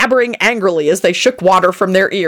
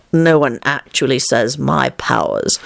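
lower, slow, weary tone; mildly exasperated; 5 semitones lower, slower than usual. A narrator's reading. Lupin to the old man, mildly exasperated. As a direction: sigh it out, mildly fed up. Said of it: "in a mildly exasperated voice".

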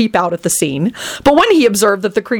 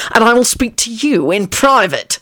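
raised-pitch ominous threat; ominous hiss; 4 semitones higher than usual. A narrator's reading. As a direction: deliver it as an ominous warning. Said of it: "Kingsley hissed ominously".

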